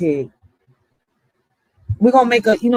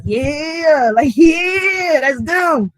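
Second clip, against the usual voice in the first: hyped agreement; 6 semitones higher, much slower than usual. Retold or imitated.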